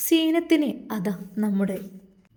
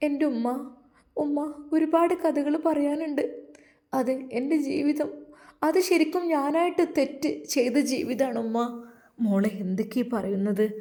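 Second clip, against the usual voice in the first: put-on higher, tearful young wife's voice; young woman confessing; 5 semitones higher than usual. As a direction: pitch up as the remorseful young wife pouring out her story. Narrator voices Zeenath confessing. (ഉയർന്ന സ്വരത്തിൽ കുറ്റബോധത്തോടെ ഏറ്റുപറയുന്ന യുവതി)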